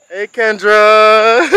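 loud, drawn-out greeting called across; calling out. Real, not acted. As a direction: call out to a friend from a distance. Greets a passing friend.

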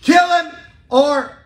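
loud, high mock protest shout; yelling protester. Protesters yelling at the prison, loud high shout. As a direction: shout it like the crowd outside. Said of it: "Not yelling".

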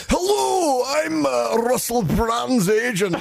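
hammed-up fake agent on the phone; Jock McGee. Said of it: "phoned up lap dancing clubs and goes".

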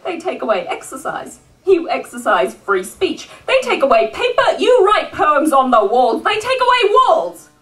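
rousing slam-poetry delivery, swelling and falling; rhythmic live poetry recital; spoken-word poem. Acted. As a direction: perform it as a defiant tribute poem. Performs a spoken-word tribute poem.

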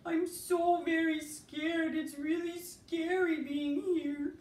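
high, slow, trembling fear; scared puppet voice. Acted.

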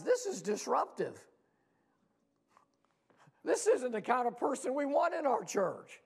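mock indignant complaint. Acted complaint of indignant church.

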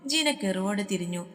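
ordinary delivery, nothing marked

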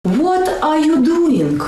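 "doing" said with a hard final G, slow; slow Russian accent demo. Shown as a demonstration. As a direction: sound out every letter in "doing" the Russian way. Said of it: "you should pronounce every letter".

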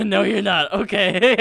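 high, loud nervous laughter. Real, not acted. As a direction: laugh it off nervously, pitch rising. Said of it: "Oh, that's a nervous laugh".

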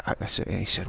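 ordinary delivery

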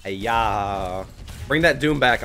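ordinary talk, nothing marked

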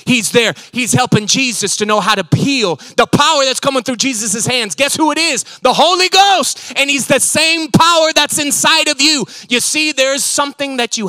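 loud, high, driving sermon delivery; full-force preaching. Acted. Preacher proclaiming the Holy Ghost to the congregation, loud and soaring. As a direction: preach it out to the whole room.

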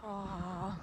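usual speaking voice

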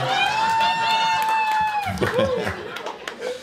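long high-pitched giggle; high cackle. Real, not acted. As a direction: let out a long squeaky laugh. High laugh.

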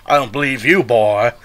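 put-on playful southern Louisiana accent; Jack Baker twang. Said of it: "That's so Jack Baker-esque".

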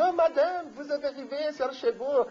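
put-on sweet, high innocent-boy voice; little angel. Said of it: "como se fosse um anjinho". (anjinho inocente, voz aguda e doce)

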